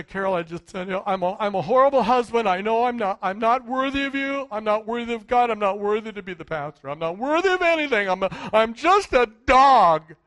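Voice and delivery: loud, quick self-pitying confession to his wife; husband to wife Carol, hammed-up self-pity; mock groveling husband. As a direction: ham up a husband wallowing in unworthiness. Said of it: "when I go around and say".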